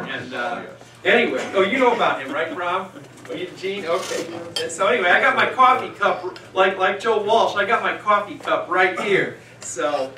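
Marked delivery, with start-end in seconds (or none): none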